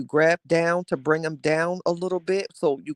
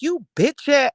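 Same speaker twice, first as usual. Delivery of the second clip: high loud mock outburst; imagined Janet yelling; 11 semitones higher, 5 dB louder, slower than usual. Imagining Janet finally yelling, high mock outburst. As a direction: fake Janet going off, pitched up. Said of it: "hear Janet like".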